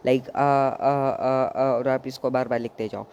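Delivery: आ, drawn out slowly and repeated; drawn-out vowel. Shown as a demonstration. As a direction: repeat आ slowly while writing. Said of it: "sound भी pronounce करते जाओ". (आ को धीरे-धीरे खींचकर दोहराना)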